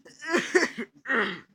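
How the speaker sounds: big loud laugh; loud burst of laughter. Real, not acted. Laughing at a funny video.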